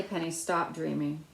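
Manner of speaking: usual speaking voice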